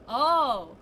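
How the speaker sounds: a startled, loud realizing oh; surprised oh. Real, not acted. Sudden realization.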